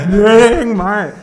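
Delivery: high, loud laughing cheer; gleeful mock cheer. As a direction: cheer loudly as if celebrating, laughing. Mock cheer at world ending. (grito de júbilo, agudo y entre risas)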